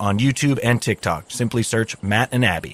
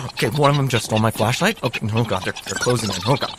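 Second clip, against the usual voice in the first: normal talking voice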